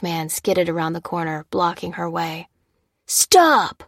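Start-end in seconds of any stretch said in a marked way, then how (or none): 3.1-3.8 high, loud, falling shout; yelled command. A narrator's reading. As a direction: yell it to halt her. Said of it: "yelled the heavily tattooed newcomer".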